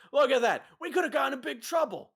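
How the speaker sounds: high, loud marvelling; impressed gang boss. Acted. Boss praising Hap for the warning, impressed. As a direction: make a big show of being impressed.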